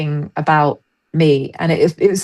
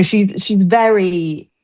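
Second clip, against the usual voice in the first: usual speaking voice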